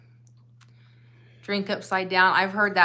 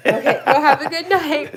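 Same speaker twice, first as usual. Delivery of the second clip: laughing; 6 semitones higher, 6 dB louder than usual. Real, not acted. Laughing goodbye to viewers.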